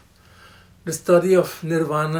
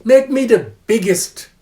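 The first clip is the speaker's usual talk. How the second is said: eager demand; 3 semitones higher, 5 dB louder than usual. Retold or imitated. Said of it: "And I said, you know".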